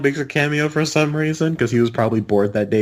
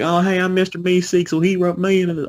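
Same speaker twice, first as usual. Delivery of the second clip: higher-pitched cartoon Southern accent; 7 semitones higher than usual. Quoting cartoon characters to friends, in a high mock Southern accent. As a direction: put on a raised, weird Southern twang. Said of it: "that weird Southern accent they all have".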